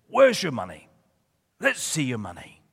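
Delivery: put-on Bucknell accent; Mister Weasel. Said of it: "That's a Bucknell accent".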